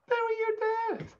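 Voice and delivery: high, slow and syrupy-sweet; sweet nice guy. Retold or imitated. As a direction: say it like a generous gift, all sugar. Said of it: "He sounds so sweet".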